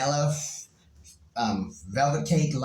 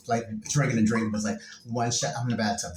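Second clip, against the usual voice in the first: ordinary talk, nothing marked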